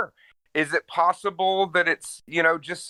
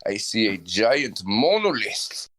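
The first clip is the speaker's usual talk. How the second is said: slow, brooding mock Dracula telling his tale; Dracula monologue; 2 semitones lower, slower than usual. For the friend, a slow hammed-up Dracula monologue. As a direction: drawl it out like a hammed-up vampire. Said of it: "set the scene where you are Dracula".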